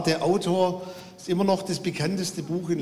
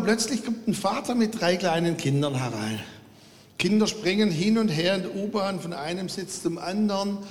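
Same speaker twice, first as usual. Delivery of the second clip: normal talking voice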